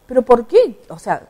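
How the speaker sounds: indignant retort. Retold or imitated. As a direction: fire back in mock outrage at the insult. Retold clash with election official.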